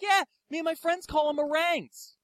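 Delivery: high-pitched imitation of a woman; mock female fan. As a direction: play her high and breezy.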